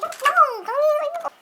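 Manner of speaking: high, slow drawn-out whine; a mock wail of I don't know; sing-song. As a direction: stretch it up high. Sung-out exasperation.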